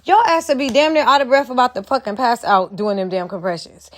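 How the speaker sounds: usual speaking voice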